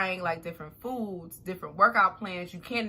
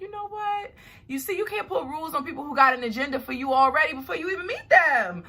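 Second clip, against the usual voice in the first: high-pitched mock comeback, rushing once it gets going; indignant retort; 8 semitones higher, 5 dB louder, faster than usual. Telling off the guy who ghosted her, indignant retort. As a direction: re-enact telling him off, building louder.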